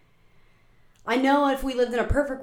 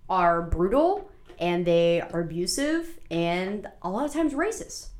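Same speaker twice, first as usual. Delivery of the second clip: slow emphatic; 2 semitones lower, slower than usual. Real, not acted. Emphatic testimony.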